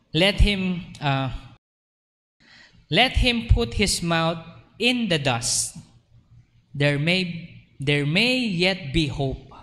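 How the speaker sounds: measured, solemn Bible reading; scripture reading. Acted. Pastor reading scripture to the congregation, solemn and measured. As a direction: read the verse slowly and gravely.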